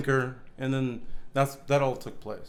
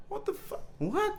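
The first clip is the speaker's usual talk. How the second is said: stunned disbelief; 7 dB quieter than usual. Retold or imitated. Retelling his reaction to cop.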